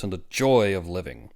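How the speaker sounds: usual speaking voice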